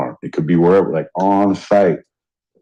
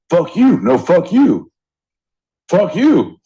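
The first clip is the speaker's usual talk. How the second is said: imitating a heated yell; 9 semitones higher, slower than usual. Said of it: "an elevated conversation".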